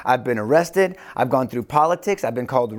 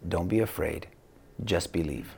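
low, hushed reassurance; close and quiet; 7 semitones lower, 9 dB quieter than usual. Acted. Jesus to Jairus whose daughter is dead, hushed reassurance. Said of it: "He must have been so close to Jairus".

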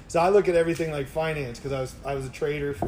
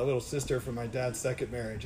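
ordinary talk, nothing marked

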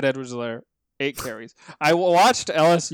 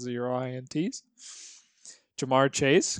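ordinary talk

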